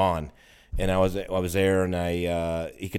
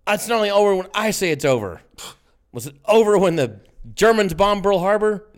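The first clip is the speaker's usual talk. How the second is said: higher, louder, hammed-up pep speech; mock rousing movie rant; 11 semitones higher, 6 dB louder than usual. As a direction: bluster like a drunk frat leader rallying the troops. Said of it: "He's on a roll".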